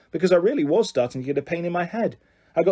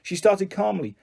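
ordinary delivery

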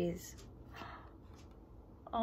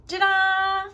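excited ta-da; 10 semitones higher, 10 dB louder than usual. Acted. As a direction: gasp then loud high ta-da. Revealing eggs excitedly.

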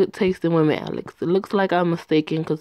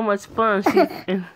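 laughing; 2 semitones higher than usual. Acted. Laughs mid-lie to Mama.

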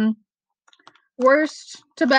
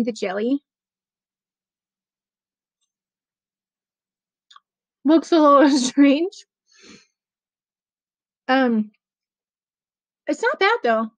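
talking while chewing. Real, not acted. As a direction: mouth partly full of marshmallow. Seen 0: Bites marshmallow at -5, shows it, then puts half in mouth.

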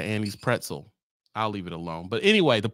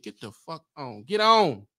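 ordinary talk, nothing marked

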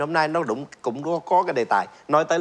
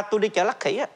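Lucky said as Lắc Khỉ, Vietnamese-style; Vietnamese accent; 6 semitones higher than usual. Retold or imitated. Old lady naming the market, Vietnamese accent. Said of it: "không nói chợ Von".

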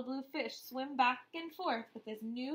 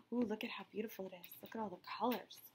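awed aside; 3 semitones lower, 7 dB quieter, much faster than usual. Real, not acted. Teacher showing picture.

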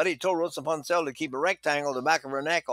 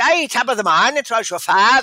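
a light Irish lilt, louder and higher; Irish accent; 5 semitones higher, 7 dB louder than usual. Retold or imitated. Teacher imitating Irish speech to show shallow phonation, light Irish lilt. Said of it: "they say".

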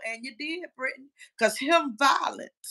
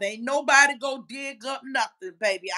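ordinary talk

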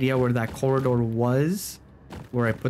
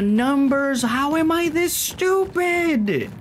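very high, slow exasperated wail; incredulous squeal; 12 semitones higher, 3 dB louder, slower than usual. Real, not acted. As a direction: wail at your own stupidity. Frustrated at self.

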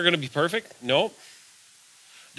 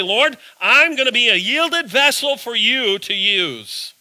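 earnest prayer; 8 semitones higher, 4 dB louder, slower than usual. Acted. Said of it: "You have to be willing to say".

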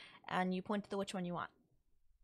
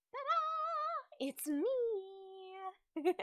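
much higher, gleeful ta-da; spring the surprise on him with delight; a playful witch popping out with a high, delighted reveal; excited reveal; 10 semitones higher, much slower than usual. Acted. Witch reveals herself to visitor.

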